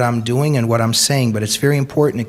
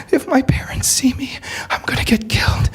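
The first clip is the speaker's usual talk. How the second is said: whispered, as if afraid to be heard; frightened whisper; 12 semitones higher than usual. Retold or imitated. Hijabi girl confides her fear of her parents, whispered. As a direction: breathe the danger out secretly. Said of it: "The hijabi girl".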